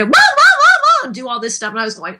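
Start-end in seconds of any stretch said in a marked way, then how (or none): 0.0-1.1 high-pitched cartoon wailing; whiny screams. Shown as a demonstration. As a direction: wail it high like a crybaby. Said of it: "They kept getting me to".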